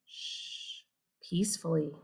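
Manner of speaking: whispered, soft hush. A narrator's reading. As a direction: hush the listeners. Narrating silent night.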